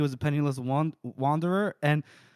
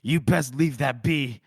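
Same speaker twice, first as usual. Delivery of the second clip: tempered warning; slower than usual. Retold or imitated. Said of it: "Temple has a bit of a temper".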